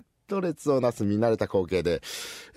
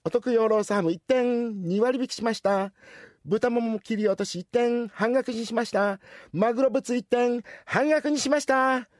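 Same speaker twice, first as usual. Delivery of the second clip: higher, bright announcing of discounts; cashier; 9 semitones higher than usual. Acted. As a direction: call out the half-price items proudly.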